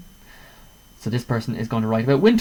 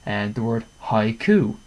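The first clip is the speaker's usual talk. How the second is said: ordinary talk, nothing marked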